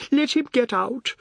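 high, loud tearful outburst; acted sob, pitched right up; sobbing. A narrator's reading. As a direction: cry it out as a wail. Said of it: "Missus Tulsi sobbed".

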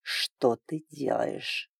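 low, slow, quiet dragon hiss; menacing hiss. A narrator's reading. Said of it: "грозное шипение".

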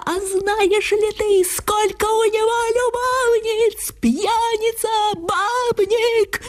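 high-pitched retold tirade, rising as it goes; mild mock shouting. Misha yelling at his wife over Chekhov, high mock shout. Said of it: "кричал Миша".

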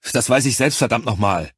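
brief, forceful burst of temper; angry outburst. A narrator's reading. Commander snapping back at Charlie's reproach, angry. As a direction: snap back at the criticism. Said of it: "bei meinem Wutausbruch".

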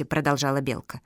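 ordinary delivery, nothing marked

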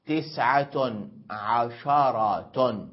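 عشرة said slowly as ʕasharah, shin with a fatha; open shin. Shown as a demonstration. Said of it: "بفتح الشين". (عشرة بفتح الشين ببطء)

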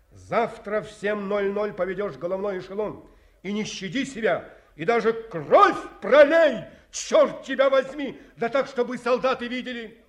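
rising to a furious bark; commanding shout. Acted. Colonel orders the captain to lead the attack, shouting. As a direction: drive the coward into battle, loud and hard.

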